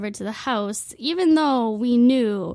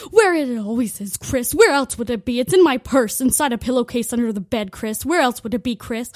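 loud, put-on mom voice scolding; impatient, obvious answer snapped at him; exasperated mom; 6 dB louder than usual. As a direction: mom scolding dad in her invented accent. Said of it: "she'd be like".

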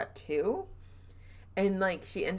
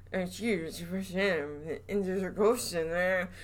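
put-on a muffled southern accent, spoken through closed teeth; slower than usual. Host imitating the caretaker's southern accent, through closed teeth. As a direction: mimic Mike's southern drawl through clenched teeth, slowly. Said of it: "his southern accent was".

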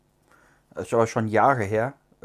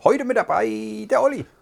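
show-opening announcement; 11 semitones higher, 4 dB louder than usual. Real, not acted. Host introduces co-host to listeners, rousing announcer call. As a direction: sing out the co-host's name like a show announcer.

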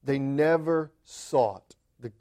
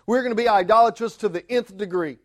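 usual speaking voice

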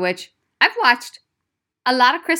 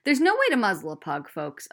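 normal talking voice

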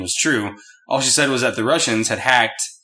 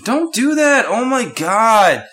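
high, squealed protest, stretched at the end; real startled protest; 10 semitones higher, 4 dB louder, slower than usual. Real, not acted. Scolding the dog that licks their face, startled squeal. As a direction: jump up in pitch, real alarm.

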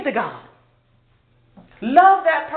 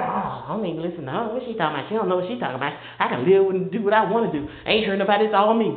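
mock dismissive; 6 semitones lower, much faster than usual. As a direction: mumble it like a sulking teen. Mimicking dismissive listener.

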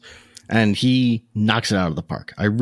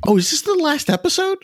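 surprised. Retold or imitated. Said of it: "I was like".

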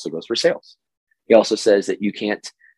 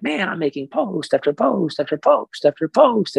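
higher, put-on voice of a weary poster; frustrated persona voice; 8 semitones higher than usual. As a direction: play the tired marketer venting, lightly. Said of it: "my little personalities aren't very good".

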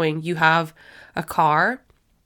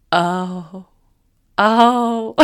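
mock realization; 3 dB louder than usual. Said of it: "and I was like".

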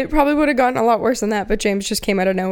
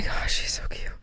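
whispered aside; 8 dB quieter than usual. Real, not acted. Cooing aside at something cute.

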